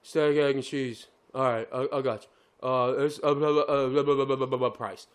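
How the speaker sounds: put-on low, dull mumbling kid; slow-witted drive-thru kid. Kid reading back the order and price, mock dim mumble. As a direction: drone through it like a clueless order-taker. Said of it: "So he's like".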